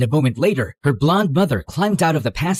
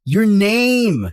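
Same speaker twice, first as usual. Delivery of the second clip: mild yell, pitched up; exasperated yell; 5 semitones higher, 4 dB louder than usual. Acted. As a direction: raise your voice in exasperation. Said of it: "Don't yell at me".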